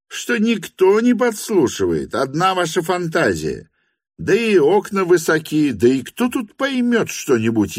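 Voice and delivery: high-pitched, exasperated argument; exasperated protest. A narrator's reading. Lyamshin assures the hostess nobody eavesdrops, high exasperated protest.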